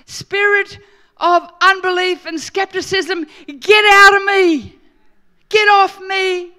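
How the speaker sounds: loud commanding shout at the spirit; yelling out. Retold or imitated. Preacher yells at the spirit of unbelief. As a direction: cast it out at full voice. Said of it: "I heard myself yell out".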